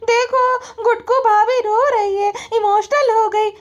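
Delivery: high, slow mocking voice; high slow mockery; teasing brothers-in-law. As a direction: tease her in a squeaky voice. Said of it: "और ये बोल रहे थे".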